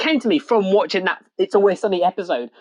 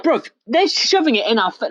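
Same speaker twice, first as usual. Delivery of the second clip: ordinary delivery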